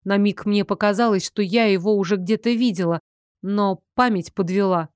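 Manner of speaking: usual speaking voice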